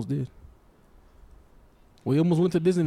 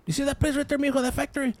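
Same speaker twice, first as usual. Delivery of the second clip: uncle voice; 10 semitones higher than usual. Retold or imitated. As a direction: play the wistful old uncle. Uncle's voice in retold story.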